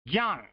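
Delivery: ज्ञान said as jñān with a clear j; ज्ञान pronounced as jnyaan; correct pronunciation. Shown as a demonstration. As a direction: open ज्ञान with a j sound, not g. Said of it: "जे के रूप में करना पड़ेगा".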